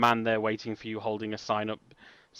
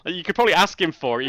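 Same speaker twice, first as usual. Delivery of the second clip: ordinary talk, nothing marked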